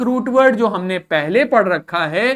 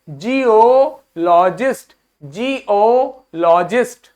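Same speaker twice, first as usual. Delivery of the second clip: Geologist spelled out slowly syllable by syllable; slow teacher demo of geologist; slow model word; slower than usual. Shown as a demonstration. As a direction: say it slowly for the class to repeat. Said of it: "बोलो मेरे साथ".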